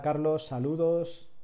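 ordinary talk, nothing marked

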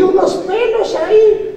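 high, teasing squeal; playful falsetto tease; mock high voice. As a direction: squeak it to poke fun.

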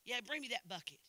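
ordinary talk, nothing marked

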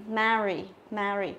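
"marry" with a wide, open æ; flat E sound. Shown as a demonstration. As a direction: keep the A of "marry" spread as in "cat". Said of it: "phải đọc thành âm E bẹt".

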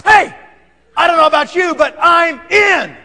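high, loud hey easing into firm defiance; defiant retort. Retold or imitated. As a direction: stand up to the father-in-law, mock-forceful. Psycho defies the father-in-law.

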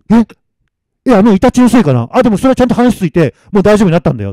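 loud, high, agitated protest; panicked reassurance. Acted. Masamitsu told he should have died, panicked. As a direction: argue urgently, voice pushed up.

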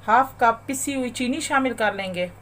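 usual speaking voice

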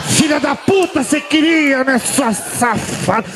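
high, laughing yell; high-pitched mock outburst, dragging out the end; shouted insult. Comic acting out the toy grabbing on, high shout. Acted outburst about the gadget.

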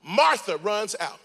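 ordinary talk, nothing marked

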